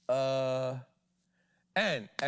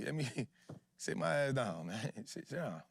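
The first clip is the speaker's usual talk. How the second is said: talking through a chuckle; amused, laugh breaking into the words; 3 dB quieter than usual. Real, not acted. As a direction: chuckle through the self-scolding, trailing softer. Jokes at himself, laughing.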